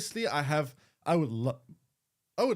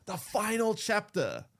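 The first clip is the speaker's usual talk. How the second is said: slow, higher, mock-dramatic trailer line; epic trailer voice; 6 semitones higher, slower than usual. Streamer mocking the epic trailer line, slow and dramatic. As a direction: lightly ham it up like a movie trailer. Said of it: "like, epic music saying".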